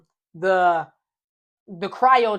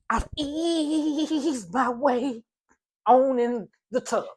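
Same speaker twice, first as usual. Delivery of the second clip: slow, laughing; 3 semitones higher, 4 dB quieter, much slower than usual. Retold or imitated. Easing into ice.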